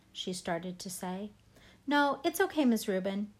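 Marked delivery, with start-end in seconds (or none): 1.9-3.3 hurried. Acted. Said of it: "I said quickly".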